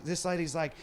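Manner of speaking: ordinary talk, nothing marked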